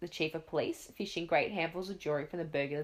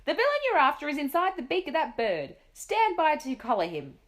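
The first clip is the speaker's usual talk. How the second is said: higher, louder commands; shouting; 8 semitones higher, 6 dB louder than usual. A narrator's reading. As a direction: Duke directing the police. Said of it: "the Duke was shouting".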